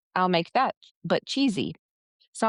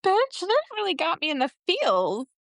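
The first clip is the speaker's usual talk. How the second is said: amazed gushing reaction; 11 semitones higher than usual. Retold or imitated. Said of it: "I'm like".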